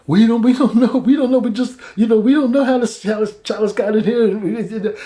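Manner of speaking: high, pleading mock panic; panicked brothers. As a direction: stammer it out, scared and high. Brothers plead before Joseph.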